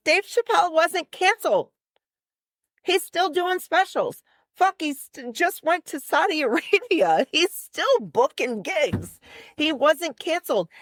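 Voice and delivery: high-pitched, insistent quoted rebuttal; emphatic protest. Retold or imitated. Quoting a comedian's defense of Dave Chappelle, emphatic protest. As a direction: argue it as if it's absurd.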